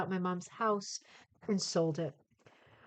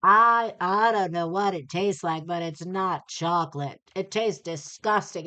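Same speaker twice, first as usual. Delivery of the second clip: loud, disgusted complaining reviewer; grumpy reviewer; 8 dB louder than usual. Retold or imitated. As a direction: blurt it out like a fed-up critic. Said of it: "one person in the Amazon thread has to be like".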